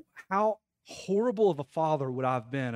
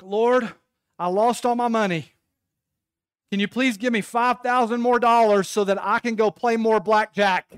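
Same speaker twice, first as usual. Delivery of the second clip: loud, raised-pitch pleading; mock desperate prayer; 5 semitones higher, 7 dB louder than usual. Gambler begs the Lord for more money, loud mock pleading. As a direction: plead to heaven, hammed up. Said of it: "at a casino and you're like".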